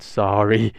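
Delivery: plain reading voice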